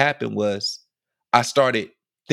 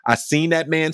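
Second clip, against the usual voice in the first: ordinary delivery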